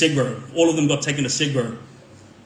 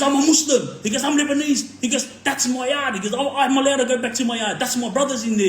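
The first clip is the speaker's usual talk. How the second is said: retold outburst; 9 semitones higher than usual. Inmate insisting to the governor he belongs in his yard, high and heated. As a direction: mimic him going off his head. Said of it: "He was going off his head".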